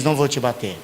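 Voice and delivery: softened parent. Retold or imitated. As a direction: soften as the heart melts. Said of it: "você se comove".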